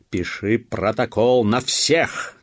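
shouted order. A narrator's reading. Police officer to his constable, shouting. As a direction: shout it out slowly. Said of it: "крикнул он уряднику".

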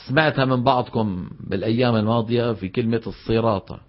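ordinary talk

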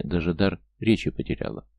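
usual speaking voice

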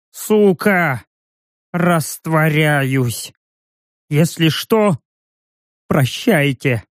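dissolving, gurgling voice; gurgling wheeze. Acted. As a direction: gurgle the words out slowly. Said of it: "Просипел, пробулькал". (булькающий сип)

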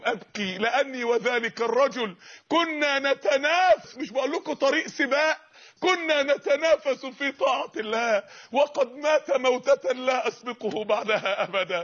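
slow, measured classical Arabic; slow grave quote. Acted. Captive telling the king why he weeps, slow and grave. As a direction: speak it slowly like a confession. Companion explains his weeping.

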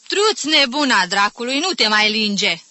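loud, irritated telling-off; scolding, shooing. Acted. Aunt chasing off the licking cat, scolding. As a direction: shoo it away at full voice. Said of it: "o izgoni Magdalena".

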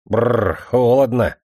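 a shivery brrr, then cold; shivering with cold. Acted. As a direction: shudder through the words. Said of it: "и поёжился". (дрожа от холода)

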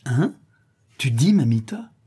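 Jean hears his father found the treasure, stunned cry; shocked outcry. A narrator's reading. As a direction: blurt it out, thrown off balance. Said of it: "S'écrie Jean bouleversé".

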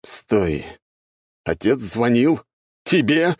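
shocked roar; roaring in disbelief. A narrator's reading. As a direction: bellow the question in disbelief. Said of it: "взревел куратор".

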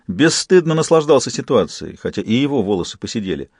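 ordinary delivery, nothing marked

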